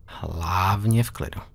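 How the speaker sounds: Hlavně v klidu drawn out slowly, stress on Hlavně; slow calming. Shown as a demonstration. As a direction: soothe a scared kid, slowly. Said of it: "repeats the phrase slower".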